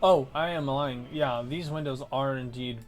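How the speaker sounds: ordinary delivery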